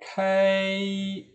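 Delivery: KAI long, high and level; first tone. Shown as a demonstration. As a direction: hold KAI out on a high pitch. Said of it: "Ini bunyi pertama". (KAI nada pertama, tinggi datar)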